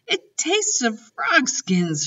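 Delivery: slow, high revolted gasp; gasped disgust. A narrator's reading. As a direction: gasp out the horrible taste. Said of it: "she gasped".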